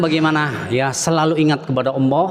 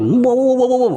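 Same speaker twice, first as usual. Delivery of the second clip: higher, louder mock yell at someone; mock rude shout; 8 semitones higher, 4 dB louder than usual. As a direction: bark it out like a rude man, playfully. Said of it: "orang yang ngomongnya kasar". (teriakan kasar pura-pura, lebih keras)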